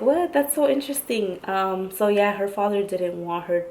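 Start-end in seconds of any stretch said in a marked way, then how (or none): 0.0-1.4 retold surprise. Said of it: "I was like".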